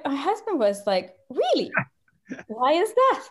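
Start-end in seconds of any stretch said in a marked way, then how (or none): 1.3-3.2 high-pitched acted surprise; puzzled, higher-pitched retort; incredulous questioning. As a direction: react in mock disbelief, voice leaping up. Husband questions the lady's remark.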